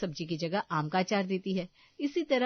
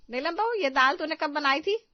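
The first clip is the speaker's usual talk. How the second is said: accusing; 7 semitones higher, 5 dB louder than usual. Acted. Mother-in-law questions about dal.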